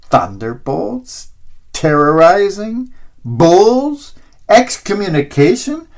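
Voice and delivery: incredulous. A narrator's reading. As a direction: repeat his words back in disbelief. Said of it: "looks at him incredulously".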